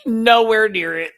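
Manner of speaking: high, loud, sassy; loud, hammed-up sassy dismissal; exaggerated dismissal. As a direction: ham it up to mock her. Repeating dismissal emphatically.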